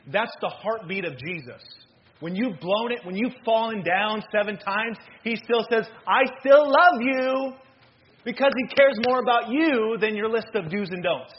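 impassioned preacher driving it home; full-force preaching. Real, not acted. Preacher assuring the fallen that Jesus still loves them, building fervently. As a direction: build to a loud, high, drawn-out climax.

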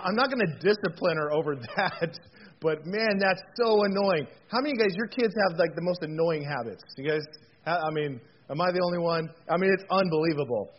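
ordinary delivery

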